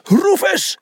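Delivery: high-pitched bellowed name; urgent, high shout; bellowing. A narrator's reading. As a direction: bellow to a teammate across the field. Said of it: "bulderde ze".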